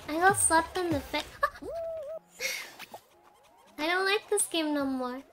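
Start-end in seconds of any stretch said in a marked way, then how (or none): 1.3-3.0 high crying squeal of laughter. Real, not acted. Slapped in the face.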